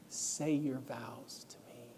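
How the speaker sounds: slow, breathy whisper, barely voiced; hushed plea from a weak voice. Weak wife whispers her plea from the hospital bed. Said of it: "she was whispering to me".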